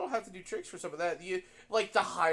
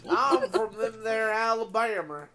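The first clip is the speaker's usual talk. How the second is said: slow, louder Southern drawl with a laugh; hammed-up Alabama accent; 4 semitones higher, 6 dB louder, slower than usual. Southerner topping friend's joke, in a laughing Alabama drawl. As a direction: lay on the country twang, higher and drawn out. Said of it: "with that kind of accent".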